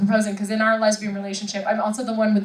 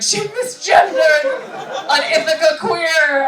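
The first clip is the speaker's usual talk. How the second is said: slow, higher mock outrage, spelled out; mock outraged commenter; 6 semitones higher, 4 dB louder, slower than usual. Stand-up mimics TikTok critics, slow mock outrage. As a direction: play an offended TikTok commenter, drawn out. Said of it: "They're like".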